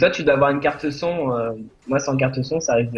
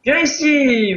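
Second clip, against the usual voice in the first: calling out; 12 semitones higher, 6 dB louder, much slower than usual. Real, not acted. Calling his pet in game.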